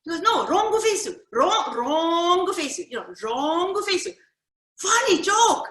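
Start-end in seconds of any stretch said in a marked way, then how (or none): 0.2-4.1 loud, slow, high wrong face, r for l; Japanese-accent English, wrongu face. Retold or imitated. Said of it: "he can't say the L". 4.8-5.7 put-on loud, much higher Japanese-accented funny joke; proud punchline, pitched way up; Japanese-accent English, loud and high; Jinglish. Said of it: "he's laughing at himself".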